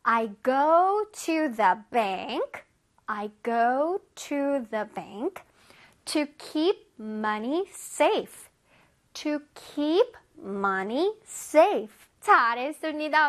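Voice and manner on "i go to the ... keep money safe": I read books at the library, every word drawn out; slow example sentence. Shown as a demonstration. Teacher reading an example sentence slowly to kids. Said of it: "우리 친구들 차례".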